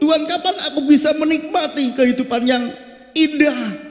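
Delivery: high, pleading sermon cry; impassioned preaching. Acted. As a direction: voice the people crying out to God. Preacher voices cries to God.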